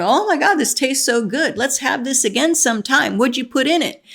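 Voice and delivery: high, loud mock raving; delighted tasters. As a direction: gush loudly like thrilled dinner guests. Acting out delighted soup tasters.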